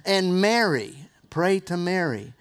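ordinary delivery

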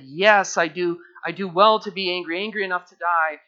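loud, defiant Jonah talking back; defiant shout. Acted. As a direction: throw the answer back at God, loud. Jonah's answer reread, defiant.

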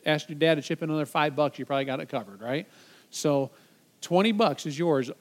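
ordinary talk

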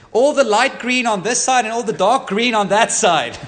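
put-on excited kid shout. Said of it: "one bright spark at the back of the bus says".

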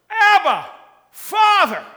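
put-on loud high cry of a child to a father; impassioned loud cry. Shown as a demonstration. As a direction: call out to the Father with full voice. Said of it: "we cry out".

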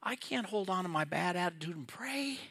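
a hint of a dreading kid muttering to himself; mild teenage groan of reluctance; sulky teenage thought. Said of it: "And I thought".

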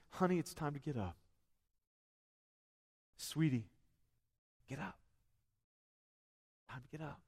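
soft and tender, fading almost to nothing; Jesus waking the little girl, softly and tenderly. Acted. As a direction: coax a sleeping child awake gently. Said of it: "talking to this little girl in a very tender way".

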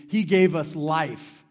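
slow, loud declaration; emphatic proclamation. Real, not acted. Preacher proclaiming to the church, slow loud declaration. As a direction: proclaim it slowly.

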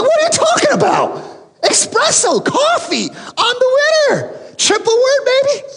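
squeaky, over-the-top disbelief; indignant gloat. Retold or imitated. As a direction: protest and gloat at once. Indignant husband arguing Scrabble word.

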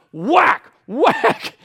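high, loud shouted blows; frustrated striking. Acted. Fed-up leader striking the rock, frustrated shouting. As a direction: shout each word like an angry hit.

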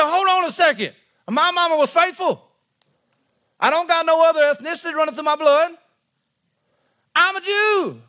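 high-pitched comic protest, rising as it goes; mock indignant. Crowd protesting to Jesus about their mama, mock indignant. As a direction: play an offended crowd answering back, pitched well up.

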